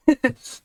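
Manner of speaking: laugh. Real, not acted. Streamer laughing at game scene.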